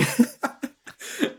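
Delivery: high airy wheeze laugh; rising silent giggle; breathless laugh. Real, not acted.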